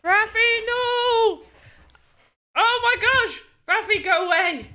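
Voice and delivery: high, loud mock-panic yells; playful panicked shouting. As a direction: yell to drive off a pursuer. Chased by game character.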